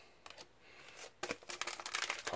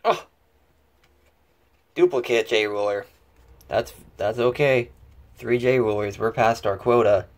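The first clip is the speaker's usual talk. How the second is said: louder, bursting with excitement over the pull; a real thrill at the duplicate rare; excited; 9 dB louder than usual. Real, not acted. Collector excitedly opening a booster box, announcing the pull.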